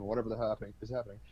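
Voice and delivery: usual speaking voice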